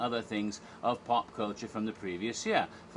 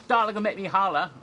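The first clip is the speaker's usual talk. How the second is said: put-on high, loud, fast pageant-kid holler; hammed-up hollering kid; Honey Boo Boo; 9 semitones higher, 8 dB louder, faster than usual. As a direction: shout it like a rowdy child contestant. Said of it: "Honey Boo Boo Child comes running out".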